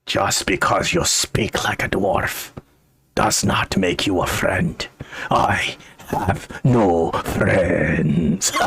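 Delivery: low, deliberate dwarf voice, dragging out the final words; embittered hermit dwarf, slowing to the end; mad old dwarf, bitter. Acted. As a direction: reject the stranger in a low character voice.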